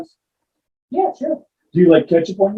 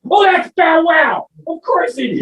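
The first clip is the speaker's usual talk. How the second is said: excited realization; 9 semitones higher, slower than usual. Acted. Dog shouting out the word he finally got. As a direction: burst out with it.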